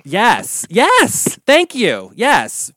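high, loud delighted yelps; excited yes. Real, not acted. Guest cheering the host's Kennedy Center praise, high and loud. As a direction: cheer the compliment.

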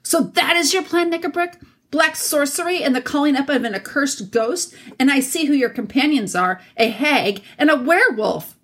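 high-pitched, outraged accusation; indignant king, voice pitched well up; thundering king. A narrator's reading. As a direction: denounce them with rising outrage. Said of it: "King Caspian like thunder".

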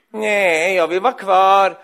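put-on loud, whiny child's refusal; protesting child. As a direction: protest like a kid who won't leave. (gnälligt protesterande barn)

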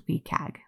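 wcag said as one word, "WEE-kag". Shown as a demonstration. Narrator giving the guidelines' spoken name, wcag said "WEE-kag". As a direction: pronounce wcag "WEE-kag", not letter by letter. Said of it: "also pronounced wcag".

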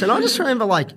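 ordinary delivery

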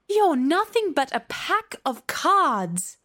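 high, drawn-out dismissal; defiant scorn. A narrator's reading. Alice dismissing the court of cards, scornful. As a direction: belittle them, stretching the words.

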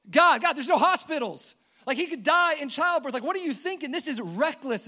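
a half-laughing protest to God; exasperated angel. Acted. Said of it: "all the angels are like".

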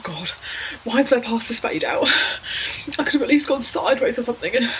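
normal talking voice

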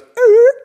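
high, loud siren blip; imitated siren. Telling friends the cop hit the siren, imitated siren. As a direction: mimic a police siren blast. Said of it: "he hits the siren".

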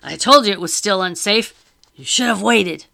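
loud, anxious rebuke; fretful scolding. Acted. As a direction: scold him nervously. Charles scolds Lockton.

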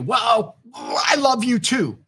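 high, drawn-out sugary answer; sweet mock reply. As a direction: play the partner melting at the compliment. Partner answers the loving words.

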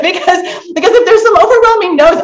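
high laughing words; high, giggly talk through a laugh. Real, not acted. Host laughing at the answers.

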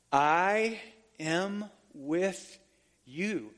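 very slow, each word drawn out; said slowly. Shown as a demonstration. Preacher slowing the promise right down for the church. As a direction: let every word land slowly. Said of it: "Say it slow".

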